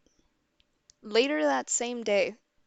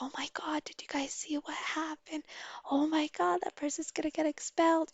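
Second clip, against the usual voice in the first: higher-pitched mock schoolkid gossip; retold buzz of scandal, pitched up; imitating gossiping students; 7 semitones higher, 4 dB quieter than usual. Schoolkids spreading news of the graffiti, imitated excited gossip.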